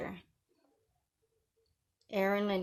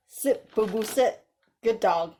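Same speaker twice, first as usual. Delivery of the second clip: louder, firm orders to a pet; loud dog commands; 2 semitones higher, 5 dB louder than usual. Pretend. Ordering haters like dogs.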